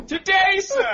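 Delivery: stuffy gentleman sparing you shame; turn of the century gent. Acted. Co-host mocks the refusal as a pompous old-time gentleman. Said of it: "doing it as good as Kyle".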